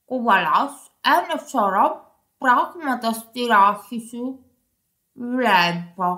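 low storybook frog voice; croaking frog. Acted. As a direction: croak out a greeting as a frog, deep in pitch. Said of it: "είπε ο βάτραχος". (χαμηλή φωνή βατράχου που κοάζει)

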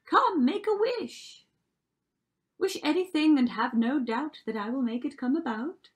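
high, loud fairy voice; crying out. A narrator's reading. Fairy offering Cinderella a wish, crying out. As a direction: proclaim it grandly as a magic fairy. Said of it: "she cried".

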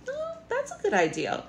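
squeaky high delight; bright high role voice; high excited reply. Acted. Acting dialogue reply.

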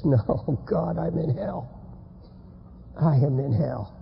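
low, hushed, slow realisation; hopeless despair. Acted. Damned man waking in hell again, hopeless despair. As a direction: let the hope drain out, sinking down.

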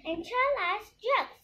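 high-pitched comic dwarf voice; funny dwarf. A narrator's reading. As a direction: chime in high as the joker. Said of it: "says the funny dwarf".

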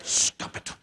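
unvoiced stern whisper; mom's whispered warning. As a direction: hiss it at the kid across the store. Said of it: "it's like".

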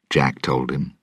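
plain reading voice

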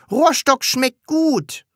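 high, slow, gleeful; cheeky delight. Acted. Pupil threatened with cane, cheekily pleased. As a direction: savour the idea happily.

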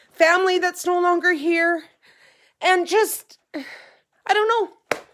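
high, tearful, halting voice; choking up, words trailing into a loss; teary. Real, not acted. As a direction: really close to crying. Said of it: "a teary day".

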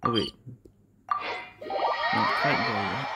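ordinary talk, nothing marked

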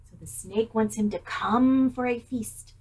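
ordinary delivery

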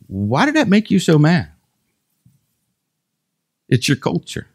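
gentle voice of the Lord. Retold or imitated. The Lord quietly questioning the preacher during the massage, softly. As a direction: ask it gently like a patient father. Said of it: "Then the Lord says".

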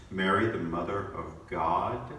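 ordinary talk, nothing marked

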